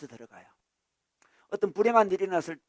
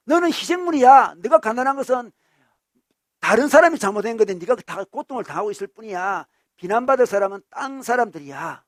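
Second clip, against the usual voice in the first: loud, raised-pitch rallying voice; agitating assertion; 5 semitones higher, 9 dB louder than usual. Acted. Progressive ideologue telling someone they are a victim, loud agitation. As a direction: stir up the listener against others. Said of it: "진보적인 이데올로기는 어떻습니까?".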